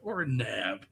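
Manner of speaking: put-on hammy American war-film accent; bad American accent. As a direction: say "Nam" with a flat American vowel, rhyming with "ham". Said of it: "best bad American accent".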